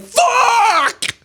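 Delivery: mock outburst over the missing pie; furious yell. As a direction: yell it in frustration. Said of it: "I was really mad".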